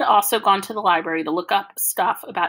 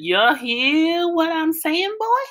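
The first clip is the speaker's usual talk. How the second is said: put-on copying the hillbilly accent; 9 semitones higher, much slower than usual. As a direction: kid copying Dad's Southern hillbilly accent, high and slow. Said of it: "an imitation of a hillbilly accent".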